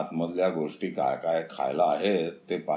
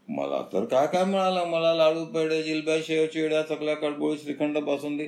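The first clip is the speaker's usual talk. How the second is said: excited boast; 8 semitones higher than usual. Acted. Hare boasts a list of sweets.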